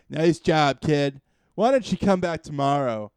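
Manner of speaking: put-on loud, patronizing old master. Drunk master sends the hero away again, loud and patronizing. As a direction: praise him like a lazy sensei stalling.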